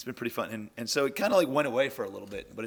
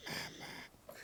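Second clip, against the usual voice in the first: monster voice; 16 dB quieter than usual. Pretend. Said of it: "Can you say mama like a monster?".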